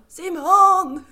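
loud high call of a name. Retold or imitated. Calling her husband downstairs in pain, a loud high call. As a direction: shout for him from the toilet. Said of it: "Och bara".

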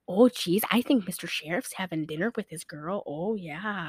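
low, soft Wisconsin mumble of a maid; hint of Wisconsin, dropping away softly; Wisconsin accent. Podcaster mimics maid covering for the sheriff, low Wisconsin accent. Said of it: "She sounds like she's from, like, freaking Wisconsin".

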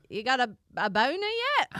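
hammed-up Australian come-on, high and loud; comically lewd Australian twang; erotic Australian accent. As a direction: lay on a broad, mock-sultry Australian accent. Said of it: "I do erotic with a strong Australian accent".

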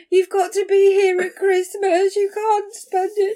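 high, loud, insistent mum voice; stern mum. Retold or imitated. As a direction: lay down the law to daughter. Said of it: "I could have gone".

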